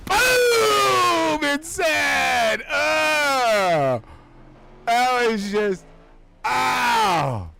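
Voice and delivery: real shouting at the crash; excited shout. Real, not acted. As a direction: yell it at the impact. Big hit, commentator erupts.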